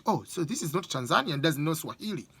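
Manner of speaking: ordinary talk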